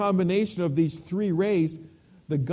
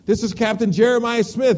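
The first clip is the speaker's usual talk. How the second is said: ship's captain hailing, a little louder and higher; captain radioing; 4 semitones higher, 6 dB louder than usual. Acted. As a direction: call out firmly as if over the radio. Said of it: "his voice crackled over the radio".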